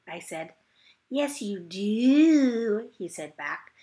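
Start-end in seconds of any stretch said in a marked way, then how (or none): 1.1-2.9 smug, stretched-out taunt; slow taunting boy. Acted. Polly Allen Puffer teases Junie B about the bed monster, slow and smug. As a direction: drag it out, teasing and sure.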